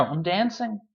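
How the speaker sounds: ordinary delivery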